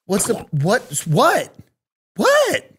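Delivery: a sudden high squeak of confusion; baffled what. Real, not acted. Reacting in disbelief.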